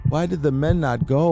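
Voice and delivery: ordinary delivery, nothing marked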